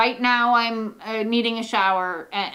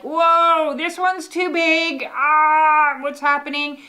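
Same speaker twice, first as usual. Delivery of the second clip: higher, louder hammed-up alarm; mock panic; 6 semitones higher, 5 dB louder, slower than usual. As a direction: play a surfer swamped by a big wave. Surfer facing a huge wave.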